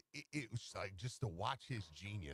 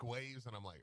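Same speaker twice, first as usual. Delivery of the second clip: usual speaking voice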